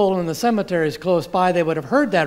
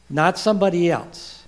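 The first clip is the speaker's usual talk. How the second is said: ordinary delivery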